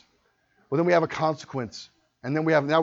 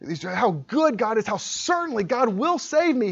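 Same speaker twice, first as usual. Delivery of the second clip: ordinary delivery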